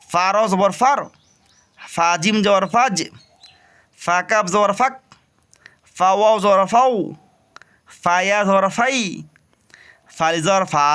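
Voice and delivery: teacher chanting the letter drill; spelling drill. Shown as a demonstration. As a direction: sound out each syllable for students. Teacher drills third line.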